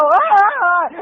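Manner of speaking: high, drawn-out yelp; startled yell. Retold or imitated. Moviegoer jumping at the scream behind, mock startled yell. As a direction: imitate a jolt of fright. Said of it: "And again I go".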